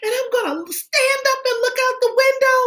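put-on high, bouncy little girl voice; excited child voice. Teacher voicing Chantel to the class, high and eager. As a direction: play an eager kindergartner.

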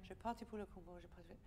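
soft, fading melancholy; quiet and sad, trailing away. Acted. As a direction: let it end in tristesse. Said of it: "I like the way you ended it".

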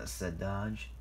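plain reading voice

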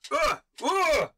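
high, loud shouted warning. Acted. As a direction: call out over the din. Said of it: "and he goes".

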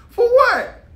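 very high, loud squeal of disbelief; incredulous outburst. Real, not acted. Asking men why they risk brotherhood, high-pitched disbelief. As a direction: shoot it way up in exasperation. Repeats question with rising intensity.

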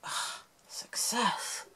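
slow, relieved whisper. Real, not acted. Finally grabs the band.

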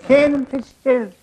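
Irish player presenting the captain, brogue; Irish accent. Retold or imitated. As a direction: introduce him proudly in a broad brogue. Irish team introduces captain.

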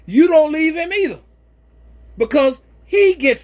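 emphatic preaching. Real, not acted. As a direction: drive the point home from the pulpit. Preaching, voice rises sharply.

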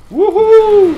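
very high, very loud victory whoop; triumphant whoop. Real, not acted. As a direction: let out a wild cheer of triumph. Escaping the projectionist. (cri de victoire très aigu et très fort)

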